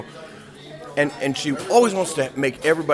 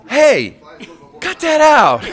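much higher, loud yell; scolding yell; 14 semitones higher, 10 dB louder, slower than usual. Retold or imitated. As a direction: yell at the couple to stop. Purser yells at the couple.

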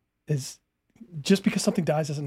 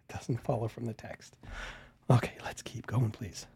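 breathless whispered plea to move on; 4 semitones lower, 8 dB quieter than usual. Real, not acted. Asking to play the video on, whispered. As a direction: whisper it, worn out from laughing.

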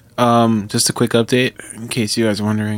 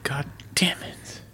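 whisper; 5 semitones higher, 11 dB quieter, slower than usual. Retold or imitated. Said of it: "I'm like".

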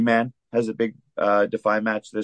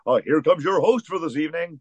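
lightly voiced arena announcer, higher; ring announcer; 7 semitones higher than usual. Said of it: "put on my best ring announcer voice".

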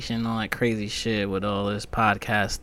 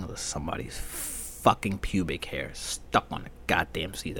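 ordinary delivery

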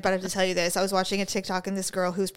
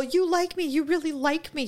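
higher, lightly mocking award-speech mimicry; mimicked gushing acceptance speech; 6 semitones higher than usual. Mocking an actress's Oscar speech, lightly mimicked gushing. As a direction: parody a winner gushing on stage. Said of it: "she did the whole".

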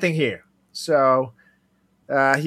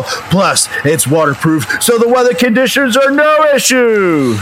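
punchy sponsor read, louder and upbeat; 10 semitones higher, 9 dB louder, slower than usual. Acted. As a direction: sell it like a football pregame hype. Ad read as football lineup.

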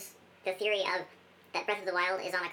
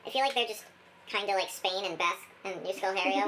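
normal talking voice

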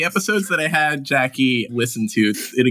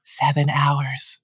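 put-on creepy child whisper; much slower than usual. GM voices creepy messenger child.

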